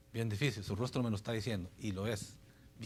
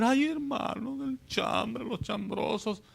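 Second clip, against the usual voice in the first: hammed-up tearful plea in a much higher voice; mock wailing; 10 semitones higher, much slower than usual. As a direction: whine it like a sinner caught out, wailing. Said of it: "Y volvió a llorar".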